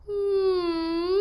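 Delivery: resonant hum up in the mask; open high hum. Shown as a demonstration. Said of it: "when you're doing this".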